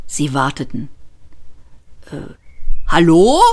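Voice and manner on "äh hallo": louder hesitant call. A narrator's reading. Ford calling out to the silent police, loud. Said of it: "rief Ford".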